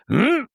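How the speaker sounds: high, unfinished throat-clearing noise; half throat clear. Retold or imitated. Tenor before singing, irritating half throat clear. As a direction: start clearing and stop halfway. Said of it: "he would do a half clear".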